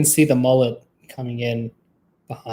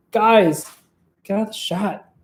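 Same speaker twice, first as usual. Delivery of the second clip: exasperated shooing; 4 semitones higher than usual. Real, not acted. Still shooing them from shot.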